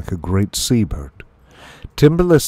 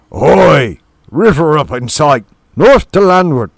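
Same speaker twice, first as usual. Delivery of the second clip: loud, raised lookout holler; gruff shrew roar; 6 semitones higher, 8 dB louder than usual. A narrator's reading. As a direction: bellow the sighting from atop the rigging. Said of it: "roared out in gruff shrew bass".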